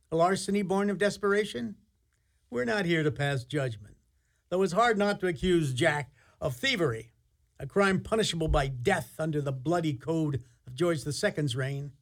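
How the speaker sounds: ordinary talk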